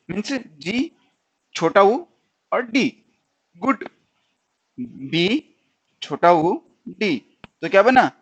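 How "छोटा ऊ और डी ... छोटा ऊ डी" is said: छोटा ऊ डी spelled out very slowly; slow spelling. Shown as a demonstration. Teacher slowly spelling good for students. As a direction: break the word into sounds slowly. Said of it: "इसको हम हल्के से".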